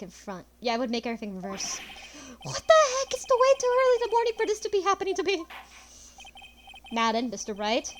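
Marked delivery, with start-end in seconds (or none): 2.4-5.4 much higher, loud shocked whine; shocked outburst. Acted. Streamer voicing Phoenix shocked at the twist, high-pitched outburst. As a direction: squeal it in morning shock.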